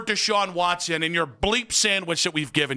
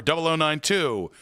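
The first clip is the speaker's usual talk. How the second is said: ordinary talk, nothing marked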